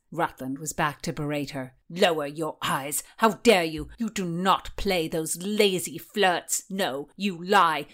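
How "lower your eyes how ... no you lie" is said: loud, furious scolding man; jealous lover shouting her down; angry berating. Acted.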